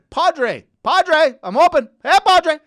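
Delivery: high, loud mock player's call; urgent pretend call to a teammate; calling out on court. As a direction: shout for the pass, hamming it up. Calls to dad on court.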